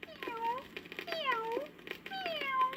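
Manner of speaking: high, soft sighing mews; kitten mews. Acted. Scolded kittens sighing, soft high mews. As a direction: mew sadly like scolded kittens.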